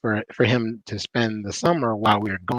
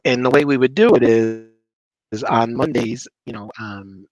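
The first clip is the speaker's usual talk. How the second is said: usual speaking voice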